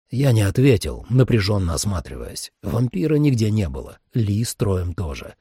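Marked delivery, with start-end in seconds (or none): none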